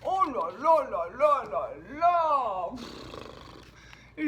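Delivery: slow, drawn-out weary moan; groaning. Acted. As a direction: groan it out, exhausted. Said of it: "gémit Monsieur Endormi".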